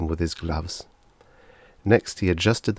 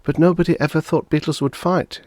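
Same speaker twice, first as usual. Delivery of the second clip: mild protest; 10 semitones higher, 4 dB louder than usual. A narrator's reading. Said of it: "protested mildly".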